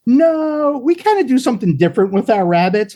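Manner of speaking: neighbor woman. Retold or imitated. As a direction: gently decline like the neighbor. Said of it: "a woman's like".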